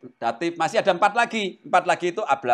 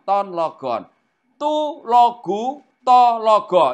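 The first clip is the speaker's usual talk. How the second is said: slow demo reading; 3 semitones higher, 4 dB louder, slower than usual. Shown as a demonstration. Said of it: "reciting Greek declension".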